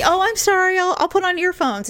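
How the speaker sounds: high, put-on apologetic voice; imitating producer apologizing to his hostile wife, high mock-sheepish; mimicked apology.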